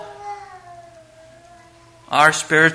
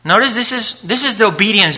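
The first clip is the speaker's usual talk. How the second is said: ordinary talk, nothing marked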